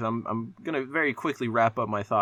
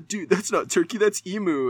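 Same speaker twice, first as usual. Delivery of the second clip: higher-pitched imitation of a dude cracking a joke; mock jokey dude; 8 semitones higher than usual. Dude delivering a supposed real-world joke to a friend, mock jokey. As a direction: put on a hammed-up joking dude voice. Mimics people joking, elated dude.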